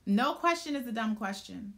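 ordinary talk, nothing marked

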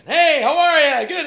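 very loud, high, drawn-out American hail; booming Americans. Retold or imitated. Six tall Americans in cowboy hats greet the actor, booming American accent. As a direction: holler a big American greeting. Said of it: "it was like six Americans".

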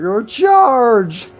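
high, loud rallying yell; battle cry. Retold or imitated. Retelling last night's quick decision to chat, rallying yell. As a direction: holler it like leading a charge. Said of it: "I was like".

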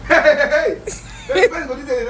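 loud, high and laughing; laughing cheer. Real, not acted. Laughing celebration.

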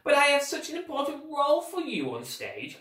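high-pitched, measured villain voice; put-on theatrical persuasion; villain feigning calm. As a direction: coax like a scheming guardian forcing calm.

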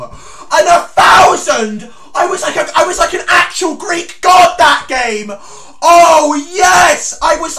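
overjoyed, shouting almost crying with delight; hysterical excited shout. Real, not acted. Sees game review accuracy.

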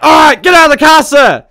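high, loud shout at the driver; police command shout. Pretend. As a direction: bark the order to get out. Officer pulling suspect from car.

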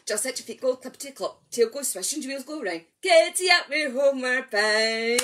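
very quick breathless chant; really fast. Acted. Leader racing the rhyme with children, very fast. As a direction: race to the end. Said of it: "really, really fast".

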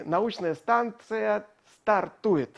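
slow, drawn-out launch announcement. Acted. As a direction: announce the launch slowly and grandly. Host launches the show.

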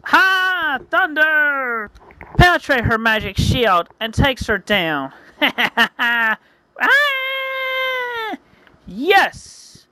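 high excited attack shouts; battle cries. Acted. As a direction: yell the attack and the win. Attack cries and witch's defeat.

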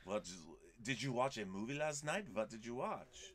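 a son imitating his mother with a bad French accent. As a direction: play the mom with a clumsy French accent. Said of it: "That's not a very good French accent".

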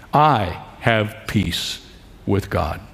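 "I" set apart, then slow, softening "peace with God"; slow, deliberate emphasis. Real, not acted. Preacher makes the verse personal for listeners, slow and deliberate. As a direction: land each phrase slowly, dropping low on "with God".

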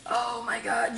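usual speaking voice

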